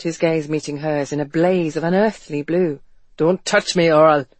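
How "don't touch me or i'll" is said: tense, cut-off warning; sharp warning. A narrator's reading. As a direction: snap a warning, then break off. Ransom snaps warning.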